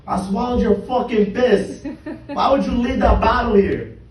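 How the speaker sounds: loud, high-pitched outraged shout; furious yelling. Acted. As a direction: scream the accusation at your father, performed for laughs. Acted yelling at father.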